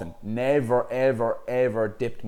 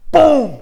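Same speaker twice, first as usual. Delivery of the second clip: 1 loud crunching boom; 16 dB louder than usual. Retold or imitated. Snapping his nose back in.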